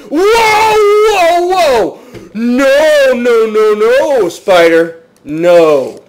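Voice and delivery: high, loud wail of panic, easing down; panicked shouting. Real, not acted. Gamer attacked by a spider, panicked shouting. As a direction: yell in alarm, pitch dropping by the end.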